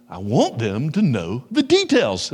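slow, pointed mock retort; sharp retort. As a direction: hit each word sharply, drawn out. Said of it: "you spoke sharply".

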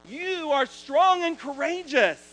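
slow, ringing prophetic declaration; a pastor prophesying strength over Joshua, at full force; full-force preaching. Acted. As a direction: preach it over him with rising power.

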